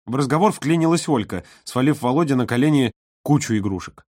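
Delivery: usual speaking voice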